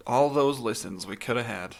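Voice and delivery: ordinary talk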